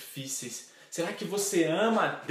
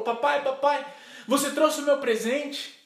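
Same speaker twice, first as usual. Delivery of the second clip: put-on high, loud child calling daddy; acted eager girl, pitched high; excited little daughter; 10 semitones higher, 6 dB louder than usual. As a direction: burst out like a child running to the door. Daughter runs to greet father.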